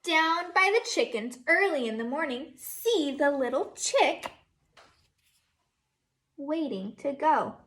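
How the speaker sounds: teacher reading Down by the Barn to her class, sing-song; reading to children. Acted. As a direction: read it like a storybook for little kids. Reads picture book aloud.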